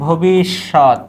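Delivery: ভবিষ্যৎ stretched out, syllable by syllable; slow, careful model of ভবিষ্যৎ; sounded out slowly. Shown as a demonstration. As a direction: draw out each part of ভবিষ্যৎ. Teacher sounds out the next word.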